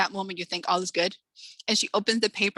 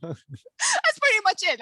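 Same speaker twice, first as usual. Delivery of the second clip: laughing; 12 semitones higher, 3 dB louder, slower than usual. Real, not acted. Laughing aside after the joke.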